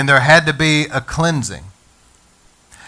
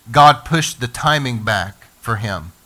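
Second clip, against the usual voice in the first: ordinary talk, nothing marked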